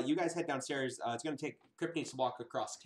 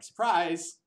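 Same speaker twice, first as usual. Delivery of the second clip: mock surprise; 6 semitones higher, 9 dB louder than usual. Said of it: "sneak in and be like".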